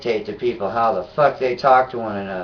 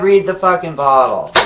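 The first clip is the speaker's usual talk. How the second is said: retold yell; 2 semitones higher, 7 dB louder than usual. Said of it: "I said".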